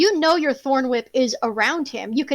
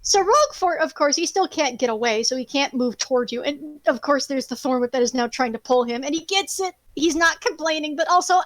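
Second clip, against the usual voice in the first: ordinary delivery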